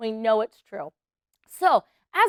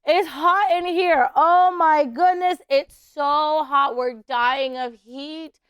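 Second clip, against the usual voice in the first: a hammed-up fanning gasp, higher and louder; overheated huffing; 4 semitones higher, 3 dB louder, slower than usual. Shown as a demonstration. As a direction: play it as sweltering in the desert heat. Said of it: "And you gotta go".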